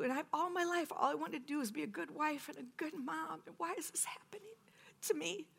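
high, strained, whispery crying plea; tearful prayer. Retold or imitated. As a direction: plead with God through tears.

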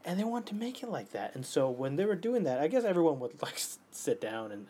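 ordinary talk

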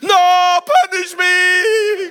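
hammed-up high shriek; child's desperate wail. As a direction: wail it very loud, drawing out the end. Son begs for punishment instead.

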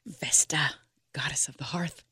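hushed, barely contained excitement. Acted. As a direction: whisper it in awe, holding back. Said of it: "She can barely contain her excitement".

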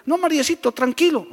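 mild imitation of a cocky teen; cheeky youngster. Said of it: "Hay jovencitos que dicen". (imitación leve de joven confianzudo)

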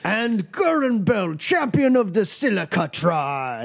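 high, ceremonial champion introduction; fight announcer. Acted. Boris announces the rival champion.

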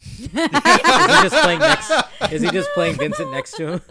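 high slow laughing question; laughing through the words. Real, not acted. Laughing at the silly idea.

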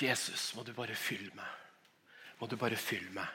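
soft, longing plea to Jesus; quiet yearning prayer, alone outside at night; hushed prayer. Retold or imitated. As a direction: pray it softly under your breath.

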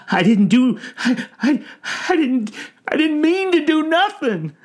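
high, loud stammering wail; tearful old man pleading, very high; distraught denial. A narrator's reading. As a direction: wail your innocence between broken starts. Old man protests, in tears.

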